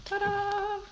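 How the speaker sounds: high, playful ta-da. Real, not acted. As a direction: present it with a flourish. Revealing something.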